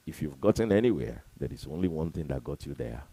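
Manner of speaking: usual speaking voice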